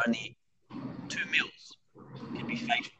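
drops to a whisper; fading into a hushed whisper. Acted. Scorpion venom facts trail off.

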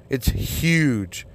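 "huge" with a strong H, stretched; H sounded. Shown as a demonstration. Insisting on the proper word, "huge" with its H stressed. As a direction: lean on the H in "huge". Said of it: "Listen to my fucking voice".

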